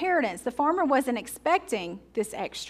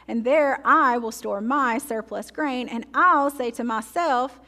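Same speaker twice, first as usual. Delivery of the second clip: slow and louder, leaning on every "I"; self-centered emphasis; 3 dB louder, slower than usual. Shown as a demonstration. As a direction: read it as a man who only thinks of himself, stressing "I" and "my". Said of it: "notice the self-centered emphasis here".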